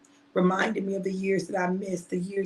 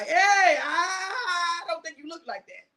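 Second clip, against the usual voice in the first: mock doubt; 9 semitones higher, slower than usual. People doubting your AI photo.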